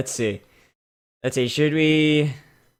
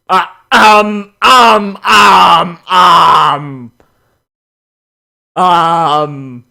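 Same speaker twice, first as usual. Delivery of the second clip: loud hammed-up stalling; mock ums; 2 semitones higher, 10 dB louder, much slower than usual. Streamer stalling loudly over the bat pony.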